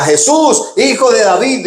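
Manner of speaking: acted plea called from the crowd; imitated street cry. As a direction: cry out as if hailing someone passing by. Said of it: "cuando alguien gritaba".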